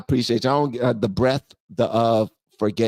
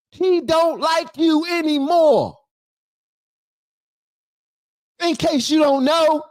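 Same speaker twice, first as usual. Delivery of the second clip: angry scolding; 5 semitones higher than usual. Acted. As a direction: tear into her for losing the race. Scolding Kamala over lost race.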